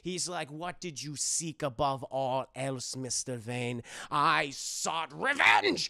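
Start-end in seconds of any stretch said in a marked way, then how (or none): 0.5-3.8 put-on low, slow German-accented question; probing mock German therapist; Hugo Strange. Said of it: "a very thick German accent". 4.1-5.9 slow, loud, hammed-up vow; mock-heroic intense confession; dramatic avenger. Bruce confessing his vow of vengeance.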